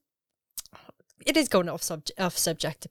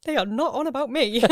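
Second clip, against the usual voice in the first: higher, breaking into laughter; laughing self-mockery; 9 semitones higher, 6 dB louder than usual. Host admitting a misread blog question, laughing. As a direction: retell your blunder, giggling as you go.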